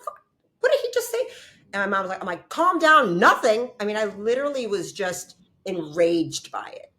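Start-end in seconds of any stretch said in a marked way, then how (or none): 0.0-1.2 high, fast shocked cry; high fast startled exclamation; shocked outburst. Retold or imitated. 2.5-3.7 high loud shouted order; mother yells at her daughter to calm down; mock loud shout. Mom telling her to calm.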